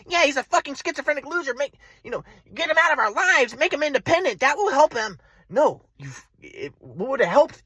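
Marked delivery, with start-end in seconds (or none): 0.1-5.2 raised mocking parents voice. Son mocking his parents' dismissal, lightly raised. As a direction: tease the parents' dismissal, pitched up. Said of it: "my mom and dad are like".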